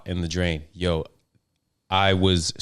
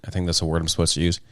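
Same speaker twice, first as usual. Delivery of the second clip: normal talking voice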